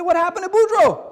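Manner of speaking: loud, high cry of alarm; acted panic over a missing friend; alarmed question. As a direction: demand it, voice shooting up. Hunters alarmed, Boudreaux is missing.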